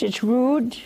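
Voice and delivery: ordinary talk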